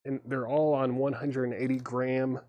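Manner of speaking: ordinary delivery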